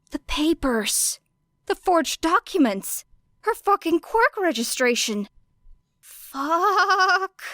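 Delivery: rising from a high gasp to a sharp, high cry of alarm; building panic. A narrator's reading. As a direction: let the panic climb line by line until it bursts. Narrator voices Era's sudden panic.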